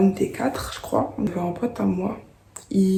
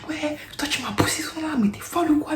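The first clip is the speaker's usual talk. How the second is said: mock accusation, much higher and half-whispered; accusation agressive; 8 semitones higher than usual. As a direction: throw it at her like a shove. La fille l'accuse au ping-pong. (la fille au ping-pong, accusation imitée, aiguë et soufflée)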